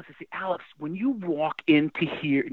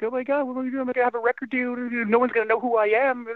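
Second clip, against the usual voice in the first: higher, mock-panicked young self; imitating scared kid; 8 semitones higher, much faster than usual. Young musician fearing his career is over, mock panic. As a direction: play your frightened younger self spiralling. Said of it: "I was a young, scared kid".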